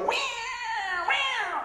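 high-pitched "wee, wee" like an alarm; siren imitation. Preacher imitating the plane's emergency alarm, high siren wail. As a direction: mimic the emergency alert on the plane. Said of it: "the emergency things go".

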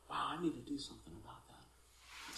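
very soft, low musing; hushed, low retold realisation; quiet inner thought. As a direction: think it aloud almost under the breath. Retells his own inward response.

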